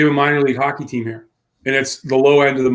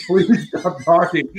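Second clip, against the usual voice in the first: slow, drawn-out please stop talking; slow fed-up plea; 3 semitones higher, much slower than usual. Retold or imitated. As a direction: beg slowly and wearily. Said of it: "one of the guys who's like".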